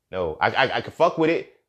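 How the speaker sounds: ordinary delivery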